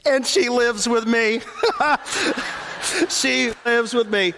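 laughing through the punchline. Real, not acted. Pastor cracking up at his own joke to the church, laughing. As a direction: let the laugh break through the words.

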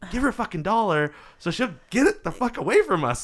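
high-pitched, exasperated plea; exasperated urging. Retold or imitated. Urging a friend to pay the dancer off, exasperated. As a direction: push it out high, fed up with your friend.

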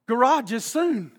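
ordinary delivery

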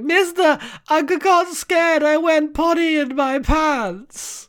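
put-on high, slow, whiny child voice; mock little girl crying. Reading a comic to his co-host, high whiny child voice. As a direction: play a scared little girl begging. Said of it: "she starts crying, like".

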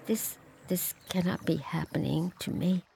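frightened whisper. Acted. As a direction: breathe it in disbelief. Joan shaken by the vision.

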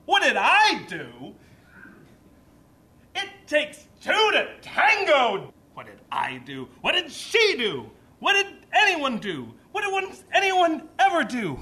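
high, stretched-out indignant outburst; imitating a defensive, exasperated rant; getting mad. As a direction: protest each question harder, climbing in pitch. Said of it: "I realized I was getting mad".

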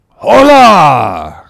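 loud, high, long-held call; hailing cry. Acted. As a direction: hail someone walking away. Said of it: "cried stationary Elijah, hailing us".

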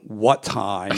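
a hint of low Batman grudgingly agreeing; gruff Batman. Batman's gruff reply, retold.

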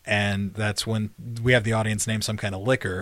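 ordinary delivery

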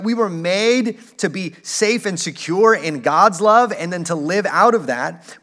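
usual speaking voice

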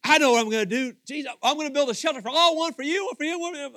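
loud, high, rushed babble; overexcited Peter. Acted. Disciple offers Jesus shelters, disoriented excited babble. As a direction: blurt out the plan, scattered and eager. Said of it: "He's so disoriented".

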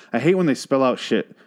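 usual speaking voice

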